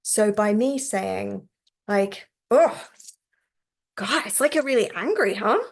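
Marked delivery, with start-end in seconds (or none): 2.5-5.6 louder and higher, matching the anger; more energy. Shown as a demonstration. Therapist mirroring a client's held anger, energized. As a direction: bring a bit of anger into it. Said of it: "bringing a bit more of that anger into my response".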